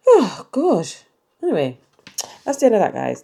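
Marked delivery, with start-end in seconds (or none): 0.0-0.9 exasperated sigh. Real, not acted. Exasperated about Salisbury traffic.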